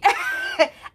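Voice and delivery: a sudden squealing laugh, very high. Real, not acted. Vlogger cracking up at her short temper, high laugh. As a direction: burst into a high-pitched laugh.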